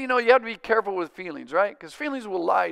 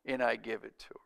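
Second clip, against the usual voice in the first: whispered; 8 semitones lower, 10 dB quieter, faster than usual. Acted. Sermon, tender aside.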